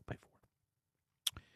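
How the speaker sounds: slow whispered "pay for it"; whispered punchline. Real, not acted. Host echoing a listener's joke in a whisper. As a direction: drop to a slow breathy whisper.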